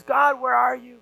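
desperate cry. Retold or imitated. A boy alone in the jungle calls to God, a retold desperate cry. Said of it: "and he cries out".

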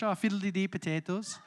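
hammed-up silly Irish accent; a cartoonish stage-Irish lilt for a joke. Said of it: "I'll stop doing silly accents now".

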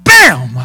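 sudden loud bam like an impact; imitated bam. Man retelling hands laid on him, explosive bam.

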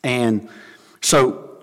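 ordinary talk, nothing marked

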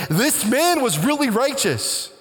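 high, proclaiming centurion voice; lightly voiced centurion in wonder; awed centurion. Centurion glorifying God.

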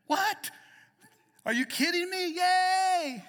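high, loud joyful yell; father yelling in delight over his daughter's news; excited shout. Retold or imitated. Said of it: "It energized me".

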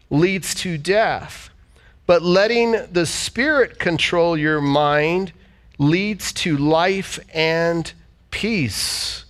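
usual speaking voice

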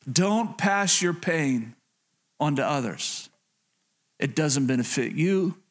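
normal talking voice

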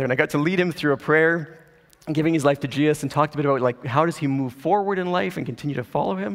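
normal talking voice

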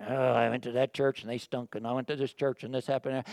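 whiny complainer. Retold or imitated. Churchgoer complains about every church, mock grumble. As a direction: mimic a sulky church-hopper.

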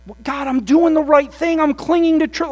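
high, loud protest to God; defensive protest. Retold or imitated. Church pleading its case to God, high and loud. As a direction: insist you did right, voice up high.

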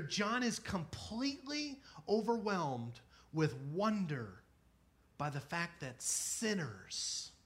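normal talking voice

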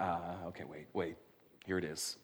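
low, soft aside, acting out Jesus; musing aside. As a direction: step back and say it low and quietly, as if pausing to think. Acted Jesus stepping back before answering.

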